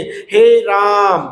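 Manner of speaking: "हे राम" with the vowels stretched very long, slowly; drawn-out call. Shown as a demonstration. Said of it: "इसका प्रयोग दूर से पुकारने में". ("हे राम" को बहुत लंबा खींचकर पुकारें)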